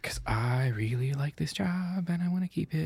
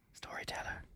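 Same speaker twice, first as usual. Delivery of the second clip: ordinary delivery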